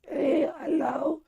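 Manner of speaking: very high, thin squeaky voice after surgery; mock a ruined high-pitched voice, ending in a whisper; squeaky falsetto caricature of his own voice. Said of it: "leave my voice like".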